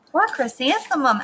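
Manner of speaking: ordinary delivery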